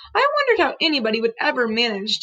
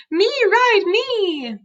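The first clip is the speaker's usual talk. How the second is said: put-on begging toddler; 9 semitones higher, much slower than usual. Toddler Fudge begs to ride.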